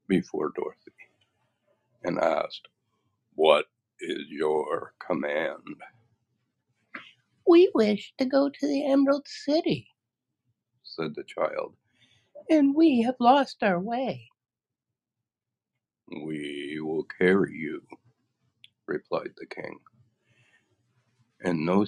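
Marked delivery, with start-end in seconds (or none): 3.3-5.8 slow, low, solemn bow; monkey king. Acted. Winged Monkey king bowing before Dorothy, slow solemn voice. 7.5-9.9 put-on high girlish voice, hopeful. Dorothy proposing to her friends, high girlish voice. 12.5-14.3 put-on high girlish voice, hopeful. Dorothy proposing to her friends, high girlish voice. 16.1-17.9 slow, low, solemn bow; monkey king. Acted. Winged Monkey king bowing before Dorothy, slow solemn voice.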